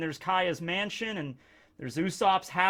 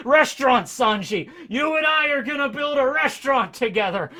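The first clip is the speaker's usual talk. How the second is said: put-on higher, louder, manic old man; delirious excitement; 7 semitones higher, 6 dB louder than usual. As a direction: blurt it out half-crazed. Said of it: "He's like".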